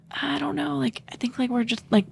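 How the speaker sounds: high, soft hedging; imitating a reluctant boyfriend; reluctant excuse.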